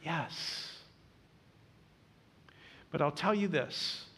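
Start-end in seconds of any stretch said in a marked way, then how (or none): 0.0-0.7 soft yes. Acted. Soft repeated answer.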